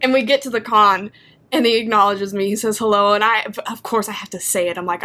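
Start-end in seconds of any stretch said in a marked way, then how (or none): none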